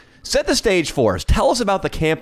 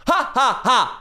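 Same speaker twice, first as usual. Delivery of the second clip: fake laugh; 10 semitones higher, slower than usual. Mock laugh at the caller.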